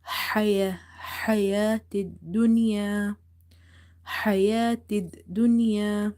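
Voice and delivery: حياة with an open, exhaled mid-throat ha. Shown as a demonstration. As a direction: open wide and push air out on the ha of حياة. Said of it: "opening your mouth wide and exhaling".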